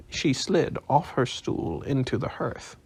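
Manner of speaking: plain reading voice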